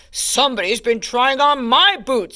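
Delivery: lightly boomed papa bear voice; a touch of booming indignation; booming big bear. A narrator's reading. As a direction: mildly boom it as a big bear. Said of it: "in his big bear voice".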